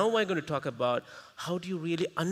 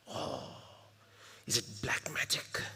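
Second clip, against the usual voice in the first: mysterious whisper; 5 semitones lower, 10 dB quieter than usual. Pretend. Mock mysterious aside.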